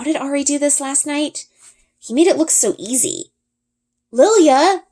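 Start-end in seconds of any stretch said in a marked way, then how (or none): 4.1-4.8 sharp, high, louder call of her name; a firm scolding call. Acted. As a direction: scold the dragon like a naughty pet. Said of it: "I scold".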